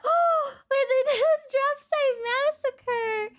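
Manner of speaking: high gasping delight with a laugh; thrilled realization; excited discovery. Real, not acted. Spots scene, gasps.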